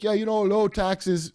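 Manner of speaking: a passing hint of a Scottish accent, slowed down. Host quoting a right-wing Scottish comedian, mild Scottish accent. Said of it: "in a Scottish accent".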